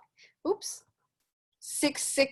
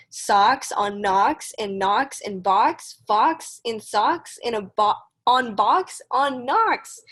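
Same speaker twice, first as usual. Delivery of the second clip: louder, slow, bouncy read-aloud rhythm; reading to children; 2 semitones lower, 4 dB louder, much slower than usual. Acted. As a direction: read it out like a storybook for kids. Teacher reads Dr. Seuss aloud.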